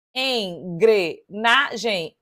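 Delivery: en-gre-na-gem, syllables drawn out slowly; split into syllables. Shown as a demonstration. Teacher checking syllable count, slow and spaced. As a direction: sound out Engrenagem syllable by syllable. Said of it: "Um, dois, três, quatro".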